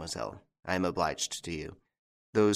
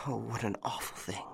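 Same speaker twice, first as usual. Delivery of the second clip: soft, high, sighing lament; sighing dismay; 4 semitones higher, 9 dB quieter than usual. A narrator's reading. Jeanne despairs over her father's illness, sighing. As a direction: let a sigh carry the words out.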